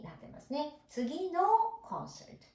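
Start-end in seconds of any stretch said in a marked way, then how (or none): 1.8-2.5 whispered word. Shown as a demonstration. Whispered gloss.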